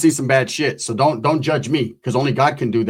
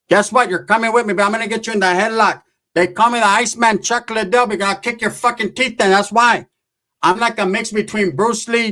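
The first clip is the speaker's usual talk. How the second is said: tough aunt threat; 6 semitones higher than usual. Retold or imitated. Comedian voicing his aunt threatening his ex-wife. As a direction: square up and threaten her. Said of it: "My tias used to".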